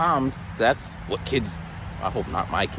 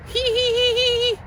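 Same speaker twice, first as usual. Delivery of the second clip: high put-on giggle; teacher imitating little kids giggling, high and silly; mock kid giggle. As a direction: tease them with a squeaky hee hee.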